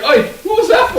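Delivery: high, laughing Cockney protest; indignant Cockney halfling; Cockney halfling squawking in protest; Cockney complaint. Acted. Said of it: "It's like".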